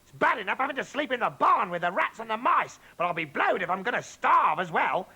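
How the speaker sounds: high, loud indignant tirade; shouting. Acted. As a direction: demand breakfast at the top of her voice. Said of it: "and she shouted".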